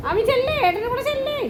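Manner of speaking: very high pet-coaxing voice; high-pitched urging. Real, not acted. Urging Ami to go with big brother, high coaxing. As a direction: coax a pet along in a high voice.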